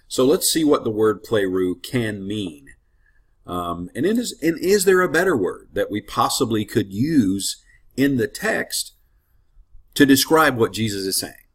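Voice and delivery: normal talking voice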